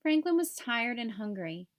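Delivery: ordinary delivery, nothing marked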